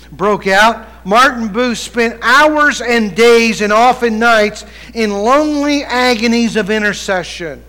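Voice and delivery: loud, slow pulpit reading; preaching. Acted. Preacher reading a revival account aloud, preaching it. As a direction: stretch the words out for the congregation.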